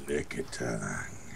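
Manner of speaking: slow, deep and quiet drawl. Pretend. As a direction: drag it out low and soft. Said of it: "say licky tongue all slow like".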